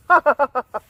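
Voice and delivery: high-pitched staccato put-on laughter; hammed-up gleeful cackling; mock belly laugh. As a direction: laugh like a boy clutching his tummy. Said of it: "he was grabbing his tummy".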